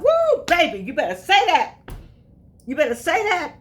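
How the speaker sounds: high, loud amen-corner approval; excited cheer. Real, not acted. Speaker hyping her own list of virtues, excited cheer.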